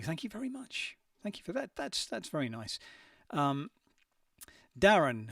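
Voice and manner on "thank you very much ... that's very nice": higher and softer, bashfully pleased; softly flattered by the compliment; flattered thanks. Real, not acted. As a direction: coo a shy thank-you.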